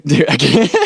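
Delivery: laughing too hard to go on; helpless laughter breaking into the words; cracking up. Real, not acted. Cracks up reading a lyric.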